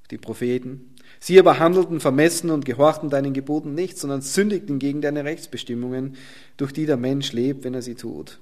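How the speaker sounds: ordinary talk, nothing marked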